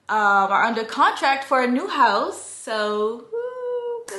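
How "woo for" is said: high, excited little cheer; a quick happy whoop; excited woo. Real, not acted. Cheering about new house.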